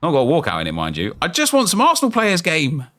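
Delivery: normal talking voice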